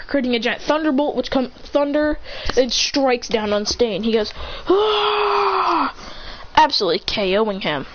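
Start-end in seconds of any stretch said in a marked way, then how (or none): 4.7-5.9 long, high mock scream; hammed-up drawn-out cry of pain. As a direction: scream as if struck by lightning. Villain is struck by lightning.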